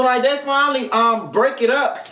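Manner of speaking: ordinary talk, nothing marked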